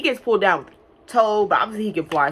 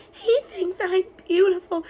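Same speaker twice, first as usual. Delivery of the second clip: put-on high, slow, swooning girly voice; high slow swoon mocking a corny scene; mock dreamy swoon; 12 semitones higher than usual. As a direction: gush it like a lovestruck heroine. Mocking corny line again.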